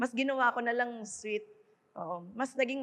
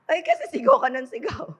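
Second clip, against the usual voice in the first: laughing; 6 dB louder than usual. Real, not acted. Laughing at constant shouting.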